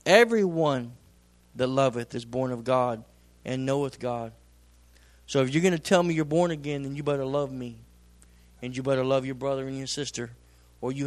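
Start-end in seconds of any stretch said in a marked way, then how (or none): none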